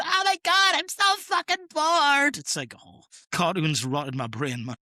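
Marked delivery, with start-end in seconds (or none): 0.0-2.4 much higher, louder, slow bored whine; cartoon whine. Podcaster quotes cartoon kid, exaggerated bored whine.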